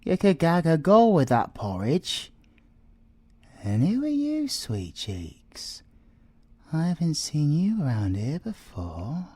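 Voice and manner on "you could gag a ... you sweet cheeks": ordinary talk, nothing marked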